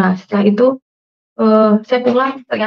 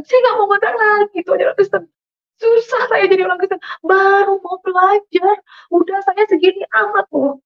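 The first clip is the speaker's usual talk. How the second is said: very high wailing lament to God; retold crying complaint; 10 semitones higher than usual. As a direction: re-enact whining through tears, pitched high. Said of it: "Saya menangis di belakang kosan". (meratap merengek, tangis yang ditirukan)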